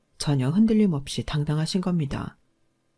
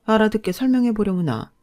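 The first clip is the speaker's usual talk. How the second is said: mother-in-law playing innocent, a little higher; innocent puzzlement; 5 semitones higher, 5 dB louder than usual. A narrator's reading. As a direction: ask as if you know nothing at all. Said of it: "전혀 아무것도 모르겠다는 순진무구한". (시치미 떼는 시어머니)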